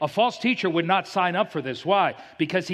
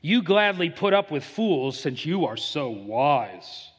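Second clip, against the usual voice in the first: slow, mock-admiring sarcasm; sarcastic; 2 semitones lower, slower than usual. Preacher voicing Paul to those putting up with fools, sarcastic. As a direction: draw it out, meaning the opposite. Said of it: "He says sarcastically".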